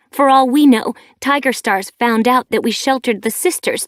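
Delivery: a daughter raging at her mother; rising rant. A narrator's reading. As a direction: pile accusation on accusation. Furious daughter rants at mother.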